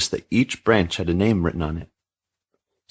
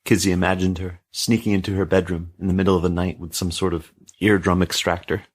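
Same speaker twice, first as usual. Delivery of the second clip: plain reading voice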